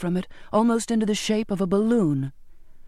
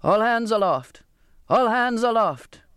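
captain's shout; 3 semitones higher than usual. A narrator's reading. Captain orders crew into the rigging, restrained shout. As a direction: call out the order with long vowels. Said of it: "breaking away to shout".

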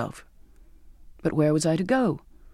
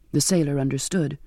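ordinary delivery, nothing marked

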